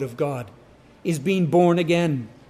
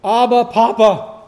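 loud, raised call for Papa; child calling urgently for Dad; crying out; 4 semitones higher, 7 dB louder than usual. Acted. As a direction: call out to get father's attention. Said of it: "you knew crying out".

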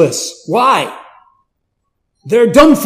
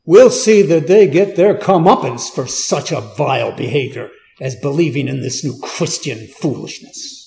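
mock outrage of a persecutor; scornful Saul; 4 dB louder than usual. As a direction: sneer at the foolish believers. Voicing Saul's contempt.

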